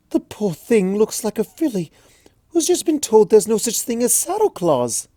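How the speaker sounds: high, refined, sympathetic lady. Acted. Rarity pitying the frozen Twilight, refined. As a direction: pity the poor thing aloud.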